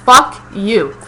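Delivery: high, loud incredulous questions, then a forceful dismissal; indignant retort. Retold or imitated. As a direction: fire back in disbelief. Retold angry retort to the manager.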